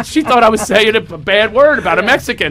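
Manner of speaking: ordinary delivery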